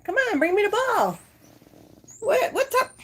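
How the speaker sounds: very high pet-talk voice; high-pitched playful coaxing; coaxing the dog. Real, not acted.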